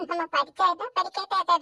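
ordinary talk

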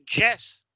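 YES said as "jess", hard J onset; wrong YES with a punched J; hard J mistake. Shown as a demonstration. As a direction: demonstrate the learner's error, YES as "JESS". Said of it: "A veces dice yes".

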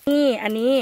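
usual speaking voice

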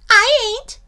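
very high, loud, cheeky squeak; snickering possum. Acted. Possum refuses to help gator, snickering squeak. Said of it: "snickers Madame Possum".

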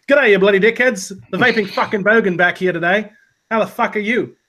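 put-on loud, high Australian bogan accent; brash Australian accent greeting. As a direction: ham up a crude Australian bogan. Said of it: "You had the pitch right".

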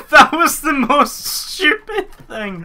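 high, slow, cracking up; laughing hard. Real, not acted. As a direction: wheeze it out laughing. Laughing at own mistake.

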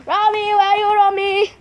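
high, sing-out call for a cat; calling out to pet. Pretend. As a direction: call around for the missing cat, pitched high.

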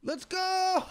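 high, loud cheer then laughter; excited shout. Real, not acted. Gamer earning the platinum, excited cheer. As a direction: celebrate loudly.

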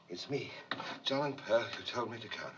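director shows the line; demo line reading. Shown as a demonstration. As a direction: say it simply, like this. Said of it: "say it like this".

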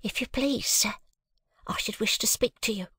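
high, hushed housemaid whisper. A narrator's reading. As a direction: ask timidly for the master. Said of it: "a housemaid came in and said".

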